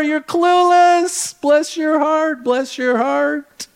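high-pitched, mock-sweet condescension; smiling insult. Southerner belittling a clueless newcomer behind a smile, mock-sweet. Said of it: "say it with a smile on their face".

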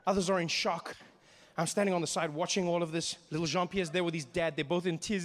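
usual speaking voice

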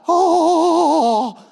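loud, drawn-out high "oh"; mock collective gasp. Preacher imitates his audience at an awkward moment, loud cringing "oh". Whole room's reaction to cringe.

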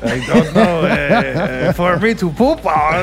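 goofy muffled sock puppet; loud, cartoonish puppet dog voice; Triumph the insult dog. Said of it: "Do your Triumph impression".

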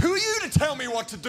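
indignant Peter. Retold or imitated. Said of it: "Peter goes".

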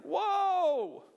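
high, loud, drawn-out whoa; shocked whoa. Real, not acted. Pastor reacting to God's command, high drawn-out whoa. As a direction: react in disbelief. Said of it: "shocked reaction to God's command".

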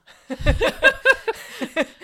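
loud, high laughing fit; burst of laughter. Real, not acted. Laughing at the bottle message.

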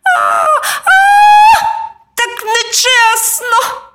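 loud squealing imp; imp crying out. A narrator's reading. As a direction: protest like a caught brat. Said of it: "вигукнув неслух".

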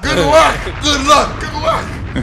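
loud, high mocking shout; mocking exclamation. Real, not acted. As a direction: scoff at the very idea, high and loud. Mocking odds of finding good man.

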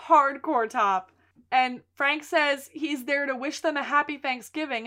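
usual speaking voice

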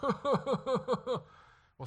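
high, loud giggle breaking out; put-on high tittering; mock stifled laugh. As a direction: act a parent failing to hold in laughter. Mimics parents failing not to laugh.

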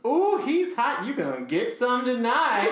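catcalling roommate. Retold or imitated. One roommate hooting at a new date, hammed-up catcall. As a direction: whoop it up like a leering roommate. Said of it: "one of them goes".

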